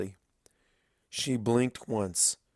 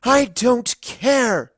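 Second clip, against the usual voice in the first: slow, much louder, higher shout; furious shout; 12 dB louder, much slower than usual. Acted. Older sister screaming back at her crying sister, slow and loud. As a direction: scream it in rage. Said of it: "through the mask of rage".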